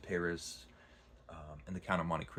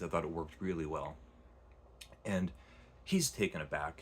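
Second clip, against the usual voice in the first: ordinary talk, nothing marked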